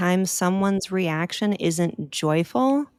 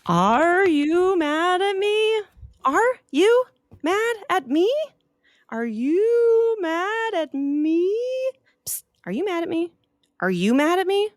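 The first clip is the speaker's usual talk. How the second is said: anxious repeated question; 12 semitones higher than usual. Acted. Opal asking everyone the same worried question. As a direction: keep asking, more anxious each time.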